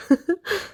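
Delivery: a bright little high giggle. Acted. Narrator giggles to the listening child.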